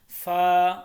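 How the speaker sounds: फा with an even long aa; held one alif. Shown as a demonstration. Teacher shows normal reading, फा evenly held. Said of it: "एक अलिफ के बराबर इसको सिर्फ लंबा".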